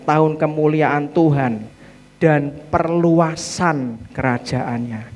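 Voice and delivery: normal talking voice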